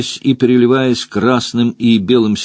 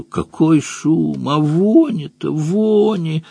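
disgusted complaint; 6 semitones higher, slower than usual. A narrator's reading. Grimacing complaint about noise and stench.

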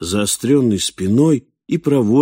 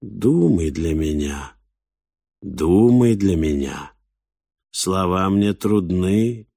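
slow, laboured creature speech; droning boar voice; slower than usual. A narrator's reading. Boar begs the man to think for it, slow drone. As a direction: stretch the words out heavily, as if speech is hard. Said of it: "низким и монотонным голосом".

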